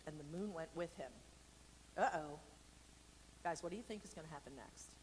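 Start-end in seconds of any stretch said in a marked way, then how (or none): none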